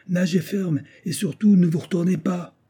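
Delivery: high, urgent called-out order; shouted warning. A narrator's reading. Companion weighing anchor to the man in the whaleboat, cried warning. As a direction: call the warning out, pitched well up. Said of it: "son compagnon qui levait l'ancre lui cria".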